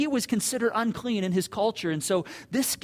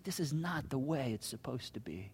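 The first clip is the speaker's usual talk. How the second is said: grieved; 6 semitones lower, 10 dB quieter than usual. Retold or imitated. Jesus grieved at brokenness.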